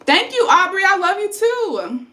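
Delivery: high, sing-out affectionate thanks, drawn out at the end; gushing thanks. Real, not acted. Vlogger answering a viewer's loving comment, real gushing thanks.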